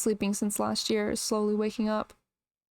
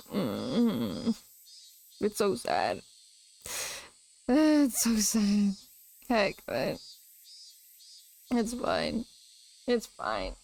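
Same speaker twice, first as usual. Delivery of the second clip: choked up; much slower than usual. Real, not acted. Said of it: "I'll freaking cry".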